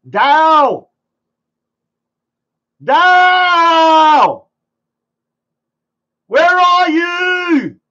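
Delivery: high, loud calls across the room; calling out for a friend on stream, real loud yells; screaming a name. Real, not acted. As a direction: yell his name to get him to answer. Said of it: "Sorry, I'm screaming".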